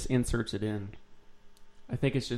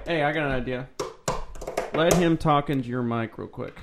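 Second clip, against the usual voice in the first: ordinary talk, nothing marked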